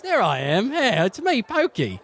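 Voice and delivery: very high, swooping goofy voice; squeaky-high character greeting; Pokey. Acted. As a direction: pitch it way up for a silly doorman. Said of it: "almost got the voice".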